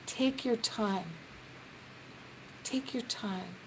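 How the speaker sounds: slow, soft, unhurried; soothing take your time; gentle reassurance. Retold or imitated. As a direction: ease the caller's worry kindly. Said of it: "I love to be able to say to them".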